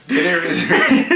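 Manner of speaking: high, loud call, breaking into laughter; yelling across the room. Real, not acted. Calling across house, laughing.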